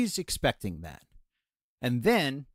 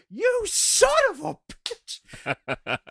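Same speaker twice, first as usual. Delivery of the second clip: high, slowly stretched curse as the wife; mock outraged yell; much slower than usual. Wife discovers second slug seconds later, imitated high yell. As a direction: shriek it slowly in her voice.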